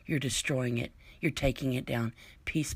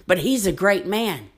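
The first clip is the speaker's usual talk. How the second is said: mocking a supporter, pitched up and loud; sarcastic mock praise; 5 semitones higher, 6 dB louder than usual. Ranting vlogger mocks Trump fans with sarcastic praise. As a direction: sneer it like a fawning fan.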